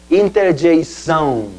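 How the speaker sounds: slow Portuguese "Interjeição", ending in "ão"; Portuguese "Interjeição", slowly. Shown as a demonstration. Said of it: "Se llaman entonces en portugués".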